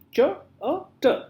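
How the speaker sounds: "ch, uh, t" as separate sounds with small gaps; split into sounds. Shown as a demonstration. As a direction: sound out "ch, uh, t" one phoneme at a time. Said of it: "chat has three phoneme".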